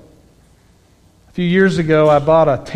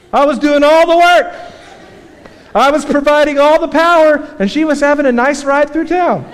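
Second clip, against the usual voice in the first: higher and louder, amused at the joke; amused outburst; 9 semitones higher, 3 dB louder than usual. Real, not acted. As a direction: play up the punchline, higher and louder. Punchline of the bike story.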